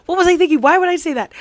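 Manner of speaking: high, loud mock panic; freaking out. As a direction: act out a flustered, regretful panic. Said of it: "she's freaking out".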